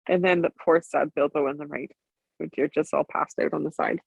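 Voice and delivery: usual speaking voice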